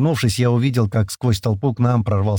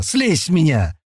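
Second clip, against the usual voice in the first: higher-pitched protesting shout; 6 semitones higher, slower than usual. A narrator's reading. Man knocked down by his beast, shouting. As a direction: yell to get it off you. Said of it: "закричал я".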